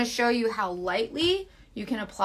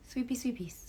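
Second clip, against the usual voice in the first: soft whispered chant; 10 dB quieter than usual. Shown as a demonstration. Showing viewers feather-light brush strokes, whispering.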